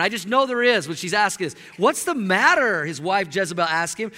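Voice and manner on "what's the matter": loud, pointed "What's the matter?"; sharp, loud tone. Retold or imitated. Wife to her sulking husband, loud and sharp. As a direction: snap the question with attitude. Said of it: "There, there's a tone".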